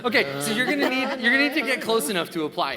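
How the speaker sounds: high, loud laughing talk. Real, not acted. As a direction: laugh through the words. Laughing at chubby baby hands.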